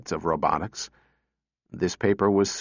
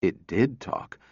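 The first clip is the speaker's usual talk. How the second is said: plain reading voice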